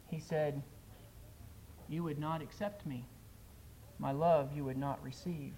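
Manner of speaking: a preacher reciting a poem with loud, pleading climaxes; dramatic poem recital. Acted. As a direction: perform the poem like a heartfelt altar call.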